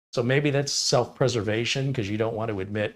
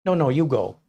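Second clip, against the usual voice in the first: mildly mock over-polite deferring; lightly imitated Midwest politeness; polite Midwesterner; 3 semitones higher, 4 dB louder than usual. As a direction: wave someone ahead courteously. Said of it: "They're very polite".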